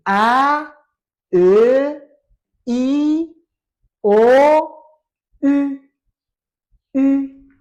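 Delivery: each vowel held, high and loud; loud vowel drill. Shown as a demonstration. Teacher models the vowels for pupils, loud and drawn out. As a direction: project each letter as a sustained model sound.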